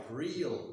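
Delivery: "real" as REE-uhl, with a glide after ee; double glide. Shown as a demonstration. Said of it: "the long vowel but the double glide".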